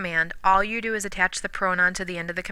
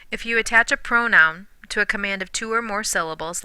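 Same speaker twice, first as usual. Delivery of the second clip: ordinary talk, nothing marked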